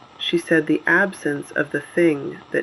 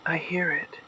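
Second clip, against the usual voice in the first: softer, brief acknowledgement; hushed aside; 8 dB quieter than usual. Acted. Broadcaster answering a colleague mid-broadcast, hushed aside. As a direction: say it quietly, off to the side.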